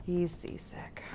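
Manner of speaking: whispered, unvoiced aside. Real, not acted. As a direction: whisper it out of pity. Whispering about seasick companion.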